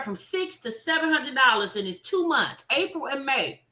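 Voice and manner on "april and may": ordinary talk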